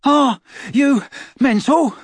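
breathless high-voiced teen boy; panting Ron. A narrator's reading. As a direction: gasp it out, winded and incredulous. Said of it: "a panting voice spoke".

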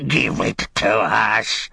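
slow, distorted creature demand; grasping wretched creature. Acted. Creature struggling with his master for the Ring, grasping, drawn-out voice. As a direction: wring the words out in a creature voice.

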